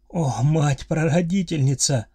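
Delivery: loud, slow sobbing cry of horror; anguished cry. A narrator's reading. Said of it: "Вскрик и затем судорожное рыдание".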